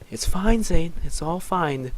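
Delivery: high, pressing voice easing off; urgent reassurance. Acted. Dunny grabbing Zane's wrist, urgent reassurance. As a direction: reassure urgently, then settle down.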